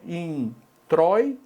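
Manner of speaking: in-troi-to split slowly into syllables. Shown as a demonstration. Teacher slowly splitting introito for the class. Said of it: "olha só".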